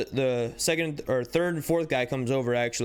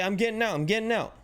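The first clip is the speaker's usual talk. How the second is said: pitched-up, hurried retold plea; urgent plea; 7 semitones higher, faster than usual. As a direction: plead that you're already getting out. Tyreek being yanked from car.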